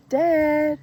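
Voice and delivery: high, loud call for his father; a little boy shouting "Dad!" up the stairs; child calling out. Retold or imitated. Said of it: "And he calls out".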